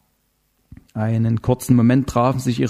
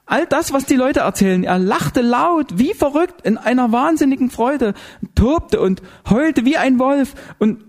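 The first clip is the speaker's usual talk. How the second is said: high, stretched, frantic telling; hysterical account; 13 semitones higher than usual. A narrator's reading. Zinzendorfer describing what they heard, hysterical. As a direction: draw it out high, as if reliving it.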